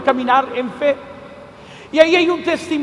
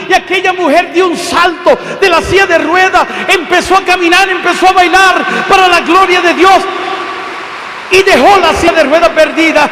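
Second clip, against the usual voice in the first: high, loud climactic preaching; a fiery sermon climax; preaching at full force; 4 semitones higher, 6 dB louder than usual. Real, not acted. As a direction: drive the story home to the crowd.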